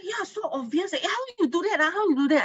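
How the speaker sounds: high, eager question; impressed colleague. Retold or imitated. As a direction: press her to share the secret. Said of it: "He said".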